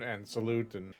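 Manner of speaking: ordinary delivery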